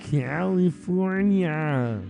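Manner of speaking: put-on long drawn-out, high kid-style California; kid saying California. Recalling a kid in the movie, imitating his drawn-out California. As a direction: draw the word way out like the kid. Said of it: "That's the way the kid said it".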